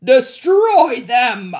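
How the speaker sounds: slow, loud roaring command; Hades' furious yell. A narrator's reading. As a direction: roar the order to your army. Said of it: "Hades yelled".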